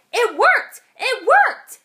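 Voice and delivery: high, loud excited cheer. A narrator's reading. George cheering to Joy after his plan worked. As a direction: shout it out with joy. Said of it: "he cheered".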